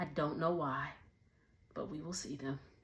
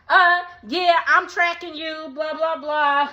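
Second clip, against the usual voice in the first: sassy mock Brooke; 7 semitones higher, 5 dB louder than usual. Voicing Brooke tracking him.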